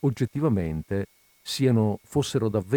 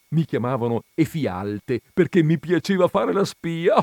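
higher, animated reminiscing that builds louder; magro eccitato; 7 semitones higher, 6 dB louder than usual. Acted. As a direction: recall school nicknames with mounting glee. Il magro ricorda i soprannomi. (ricordi d'infanzia con eccitazione crescente)

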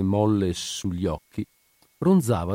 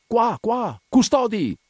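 much higher call toward the door; 13 semitones higher, 3 dB louder, slower than usual. A narrator's reading. Cosentino calling the guards to the door, loud shout. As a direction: call the guards from across the ward. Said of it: "Cosentino gridava anche lui".

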